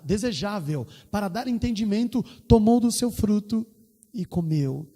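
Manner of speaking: high, impassioned preaching voice; fervent preaching. Acted. Pastor retelling a testimony to the congregation, fervent high preaching. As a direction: preach it out, lifting the whole church.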